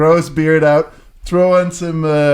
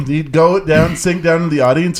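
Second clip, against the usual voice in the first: normal talking voice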